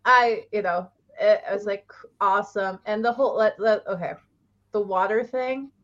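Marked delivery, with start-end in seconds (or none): none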